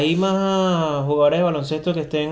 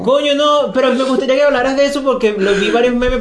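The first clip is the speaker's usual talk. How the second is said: higher, louder, laughing eagerness; amused excitement; 9 semitones higher, 5 dB louder than usual. Real, not acted. Co-host begging to hear about the memes, excited and amused. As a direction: jump in loud and high, tickled.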